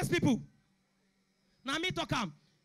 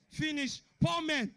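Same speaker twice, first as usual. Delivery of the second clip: usual speaking voice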